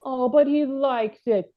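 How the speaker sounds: ordinary delivery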